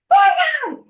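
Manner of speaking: very high, loud gasp of disbelief; shocked shriek. Retold or imitated. Telling Jess on the phone she found the earring, shrieked high and loud. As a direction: shriek it like you can't believe your eyes. Finding lost earring in bag.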